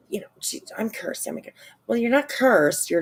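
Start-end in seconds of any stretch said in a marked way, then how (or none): 0.8-1.5 breathy mocking whisper of a self-pitying line. Streamer mocks the cursed girl in a whisper. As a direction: hush it out like a sulky mock.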